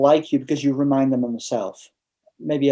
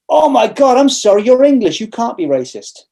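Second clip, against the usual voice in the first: high, loud, flustered apology, dropping at the end; acted startled apology; 7 semitones higher, 5 dB louder than usual. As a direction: exclaim it like someone suddenly realizing their mistake. Retold apology from would-be aggressor.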